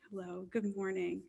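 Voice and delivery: ordinary talk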